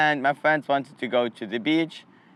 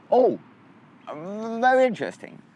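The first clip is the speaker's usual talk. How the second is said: mock intrigued; 9 semitones higher than usual. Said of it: "I'm like".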